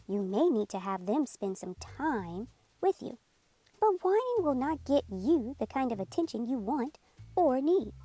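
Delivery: ordinary delivery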